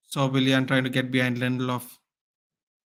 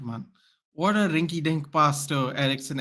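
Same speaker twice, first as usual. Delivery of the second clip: ordinary delivery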